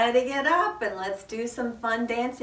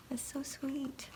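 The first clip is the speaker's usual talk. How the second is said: soft unvoiced aside; whisper; 3 semitones higher, 11 dB quieter, slower than usual. Real, not acted. Cooing to a child over a picture, whispered.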